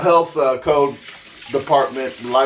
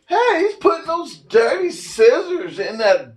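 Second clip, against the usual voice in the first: high, drawn-out, scandalized mock complaint; annoying commenter voice; 8 semitones higher than usual. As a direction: drag it out high, like a disgusted nag. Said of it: "that's the annoying voice I get in my head".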